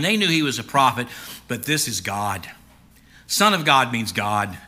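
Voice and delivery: normal talking voice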